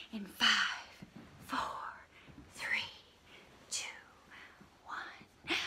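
hushed countdown; countdown whisper. Acted. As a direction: whisper the numbers down. Countdown before blast off.

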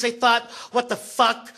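ordinary delivery

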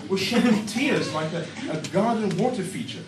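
usual speaking voice

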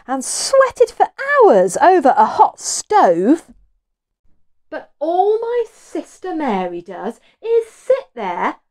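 loud, high, slow grumbling; moaning Martha. A narrator's reading. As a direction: complain loudly about the work. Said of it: "moaned Martha".

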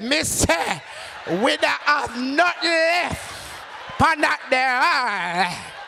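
high, very slow Jamaican over-enunciation; talking to the deaf. Retold or imitated. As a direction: stretch each word as if she's deaf. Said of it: "act like you're deaf".

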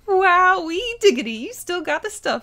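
whooping start, high and loud, then easing; excited cheering sister. Acted. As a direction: hype her up like a delighted sis.

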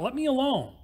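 mild mock yell, pitched high; high-pitched storyteller's version of a yell; narrated yell. A narrator's reading. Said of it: "and I yelled out".

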